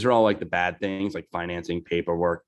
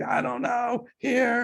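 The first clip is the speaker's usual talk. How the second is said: much higher small lost voice; mock helpless tourist pitched way up; much slower than usual. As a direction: squeak it out like a lost, scared kid. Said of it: "And I'm like".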